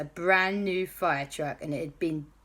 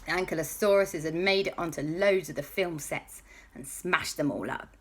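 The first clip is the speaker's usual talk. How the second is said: usual speaking voice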